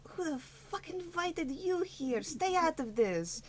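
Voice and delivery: annoyed halfling. Acted. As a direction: brush the intruder off like a cranky small halfling. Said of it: "the halfling just goes".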